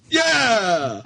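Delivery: high, drawn-out teasing whoop; cheering. Pretend. Pirate cheers on annoyed crewmate, high teasing yell. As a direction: keep up the over-keen cheer. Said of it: "cheering you on every time".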